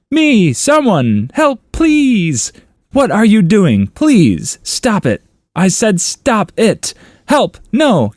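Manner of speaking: high, exasperated mock calls; mock yelling. Owner calling and scolding four dogs, mock yell. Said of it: "If I heard him yell out those names".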